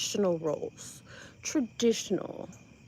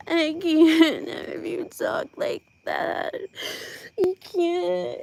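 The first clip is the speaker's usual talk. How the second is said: slow, higher, whiny mock scolding; mock prissy scolding; 7 semitones higher, 3 dB louder, much slower than usual. Mocking Heather scolding someone for swearing, whiny and slow. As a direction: drag it out like a pearl-clutching scold. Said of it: "she's like".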